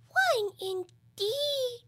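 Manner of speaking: high, very drawn-out affronted creature; indignant. A narrator's reading. Dormouse resents Alice's doubt, indignantly. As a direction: stretch it into an offended squeak. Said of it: "said the Dormouse indignantly".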